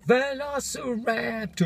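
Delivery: slow, high drawn-out monster voice; mock dinosaur. Vlogger hamming up the album title as a dinosaur. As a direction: stretch it out like a lurking beast.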